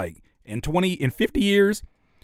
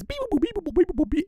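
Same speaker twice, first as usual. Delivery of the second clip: robotic beeps; faster than usual. Pretend. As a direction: imitate a tinny synth beat. Mocking future beats.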